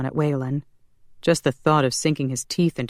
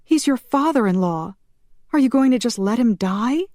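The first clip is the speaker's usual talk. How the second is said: high, desperate reproach; indignant cry; 9 semitones higher, 3 dB louder than usual. A narrator's reading. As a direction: reproach him, pitched high. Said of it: "Shanna cried".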